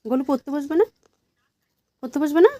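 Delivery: high, rising coaxing question; coaxing sing-song. Real, not acted. Mother coaxing her toddler to study, high and rising.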